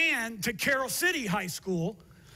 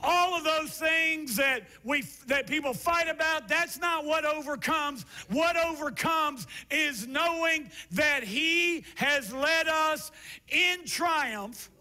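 higher, ringing shouted preaching; full-force preaching; 4 semitones higher than usual. Acted. Pastor preaching the climax to the church. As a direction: drive it home to the congregation.

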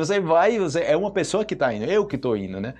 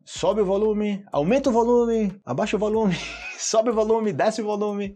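1 playful commands; 7 semitones higher than usual. Shown as a demonstration. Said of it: "teacher demos volume commands".

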